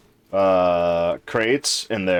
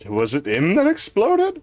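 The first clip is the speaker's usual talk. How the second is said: surprised; 11 semitones higher, 3 dB louder than usual. Real, not acted. Reacting to explosion in game.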